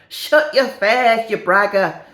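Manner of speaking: loud, slow northern put-down; mock telling-off in northern English; hammed-up northern English retort; northern English accent. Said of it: "have a go at the accents".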